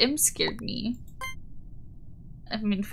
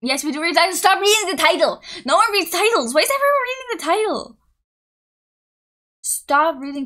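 loud, high, worked-up rant; loud protest climbing higher; exasperated outburst; 7 semitones higher, 6 dB louder than usual. Real, not acted. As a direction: raise your voice in rising exasperation. Exasperated outburst at viewers reading titles.

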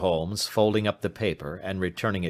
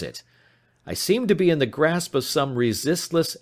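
higher-pitched, understated despair; helpless dread; 7 semitones higher, 4 dB louder than usual. Acted. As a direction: confess feeling trapped, pitch lifted. Client confesses helpless dread.

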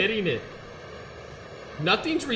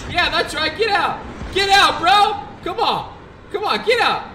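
much higher, louder triumphant yelling; shouting taunts; 10 semitones higher, 7 dB louder than usual. Real, not acted. Streamer chasing an enemy jet away, loud taunting shouts. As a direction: chase him off at full volume.

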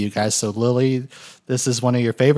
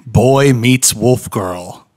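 slow dramatic title read; 5 dB louder, much slower than usual. Acted. Reading the episode's movie title.

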